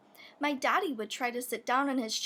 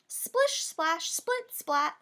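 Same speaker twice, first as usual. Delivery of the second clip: high squeal; 5 semitones higher, slower than usual. Acted. Sound words of flooding.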